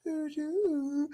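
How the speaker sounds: put-on high soft baby coo. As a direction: imitate a baby cooing. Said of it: "we'll hear, like".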